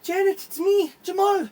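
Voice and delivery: Jamal calling out to his sister, high and excited; excited reunion. Acted. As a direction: announce yourself to your sister with joy. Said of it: "Jamal's like".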